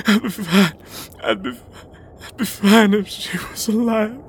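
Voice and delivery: broken, tearful sobbing confession; grief-stricken crying. Acted. Man mourning his dead bird to his driver, crying. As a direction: fall apart over a lost pet.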